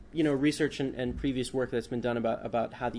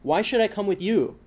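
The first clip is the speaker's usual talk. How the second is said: higher, louder indignant challenge, narrated; 8 semitones higher, 7 dB louder than usual. A narrator's reading. Journalist challenging men waiting in his office, indignant. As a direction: push back with affronted protest, in a reader's voice. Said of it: "Memarian responded indignantly".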